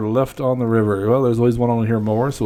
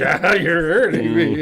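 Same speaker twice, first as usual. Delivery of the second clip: higher, drawn-out mock yelp of pain; pained complaint; 6 semitones higher, slower than usual. Man pinned by his cousin at Christmas, mock-pained whine. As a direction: protest slowly, hamming up the hurt.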